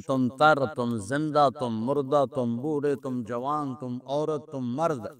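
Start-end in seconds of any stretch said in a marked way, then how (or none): none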